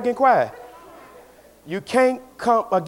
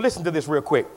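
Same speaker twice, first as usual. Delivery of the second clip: usual speaking voice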